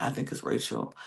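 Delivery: hushed, unvoiced naming of Rachel; whispered accusation. Ferran whispering his suspicion to Roxy, conspiratorial. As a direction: lean in and whisper the name. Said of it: "He's like".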